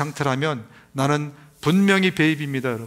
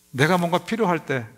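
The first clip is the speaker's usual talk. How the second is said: ordinary talk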